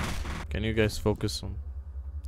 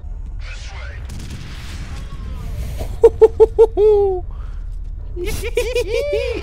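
high, loud hooting laugh; gleeful cackle; 6 dB louder than usual. Real, not acted. Player delighted by the rocket launcher, gleeful high laugh. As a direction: giggle high in quick bursts.

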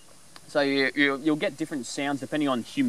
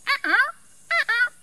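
very high, slow squawking cockatoo cry; cockatoo screech; slower than usual. Retold or imitated. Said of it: "he's like".

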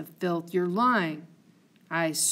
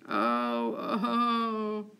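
moan; 2 semitones higher than usual. Acted. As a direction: moan in grief. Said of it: "go ahead and moan".

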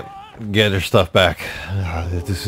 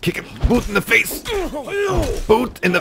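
frustrated yelp. Real, not acted. Frustrated kicking in game.

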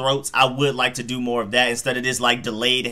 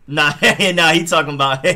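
laughing; 4 semitones higher, 5 dB louder than usual. Real, not acted. Laughs at commentator's joke.

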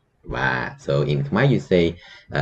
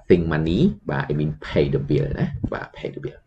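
ordinary delivery

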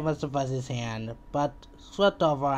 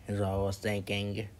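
plain reading voice

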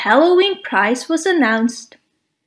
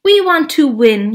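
put-on loud, boastful kid's yell, a bit higher; 5 semitones higher, 4 dB louder than usual. A narrator's reading. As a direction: bellow it like a show-off. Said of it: "yelled Stumpy".

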